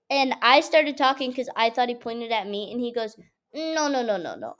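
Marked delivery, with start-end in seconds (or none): 3.5-4.6 imitating a dismissive string of no's, falling in pitch; dismissive cut-off. Professor cuts off her talking.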